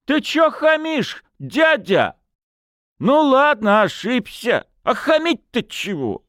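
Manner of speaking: high, whiny tipsy complaint; aggrieved drunken lad; indignant. A narrator's reading. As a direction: protest with high-pitched hurt pride. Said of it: "возмутился гармонист".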